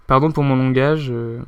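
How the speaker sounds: ordinary talk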